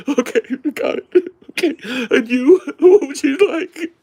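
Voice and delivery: very high, loud mock sobbing; whining and crying. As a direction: squeak the questions out through fake tears. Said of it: "she's whining and crying to every customer".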